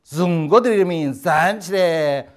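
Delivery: put-on slow, loud, accented 人民站起来 speech; Mao Zedong's accent. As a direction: boom it out like a leader to a crowd. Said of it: "마오쩌둥 연설하는데 어쩌는지 아십니까".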